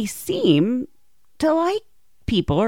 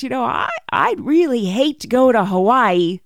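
louder, higher coy hinting; sly hint; 5 semitones higher, 7 dB louder than usual. Retold or imitated. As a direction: plant the seed with mock reluctance. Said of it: "she goes".

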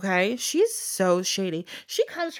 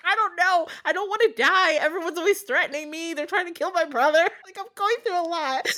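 a high, hurried, whiny plea; flustered maiden; 9 semitones higher than usual. Acted. Maiden pulled from the pit explaining herself, high and flustered. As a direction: babble your troubles out.

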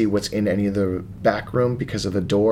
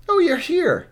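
NPC greeting; 10 semitones higher than usual. Acted.

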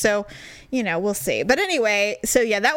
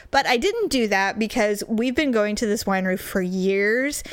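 ordinary talk

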